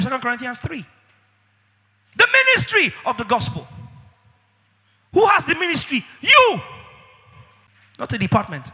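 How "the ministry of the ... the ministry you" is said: high, loud calls building to You; fiery rising exhortation; preaching shout. Acted. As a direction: call the answer out to the crowd. Preacher calling out to congregation.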